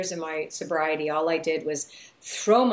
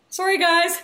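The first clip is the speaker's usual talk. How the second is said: laughing; 11 semitones higher, 7 dB louder than usual. Real, not acted. Laughs apologizing for the gum.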